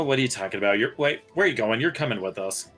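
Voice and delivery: ordinary talk, nothing marked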